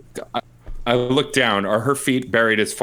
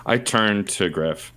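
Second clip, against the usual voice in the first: normal talking voice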